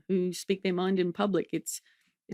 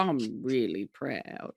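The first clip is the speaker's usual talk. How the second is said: lower, slow mock Mum voice; impersonating Mum; 6 semitones lower, 4 dB quieter, much slower than usual. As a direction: drawl it out like her mum. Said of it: "I like impersonating my mom".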